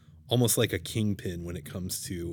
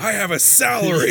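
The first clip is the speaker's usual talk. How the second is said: mock tough guy; 10 semitones higher, 7 dB louder than usual.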